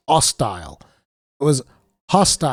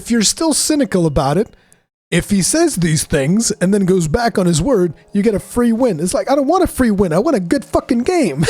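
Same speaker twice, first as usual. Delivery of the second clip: mocking mimicry; 4 semitones higher, 3 dB louder than usual. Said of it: "the way that he just goes about it is".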